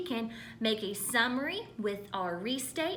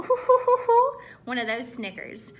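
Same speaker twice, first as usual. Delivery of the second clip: mock snicker; 9 dB louder than usual. Shown as a demonstration. Teacher demos a snicker for the class: loud hooting laugh. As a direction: play out a cheeky snicker. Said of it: "It's like a ooh".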